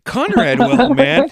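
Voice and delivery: high, loud and sure of himself; loud confident call. Retold or imitated. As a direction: call it out like you've got the answer. Johnny's loud answer, retold.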